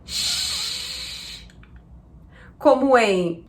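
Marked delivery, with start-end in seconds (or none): 0.1-1.5 soft voiceless sh; sh sound. Shown as a demonstration. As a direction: hiss out sh. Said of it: "som de sh".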